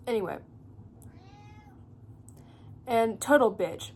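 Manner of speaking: ordinary talk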